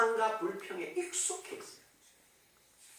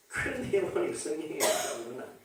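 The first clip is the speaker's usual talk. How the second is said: ordinary talk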